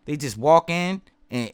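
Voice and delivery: ordinary delivery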